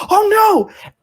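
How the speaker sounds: high, loud "oh no" shriek; horrified shriek. Acted. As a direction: shriek it out in alarm, acting the creature. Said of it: "he, like, shrieks".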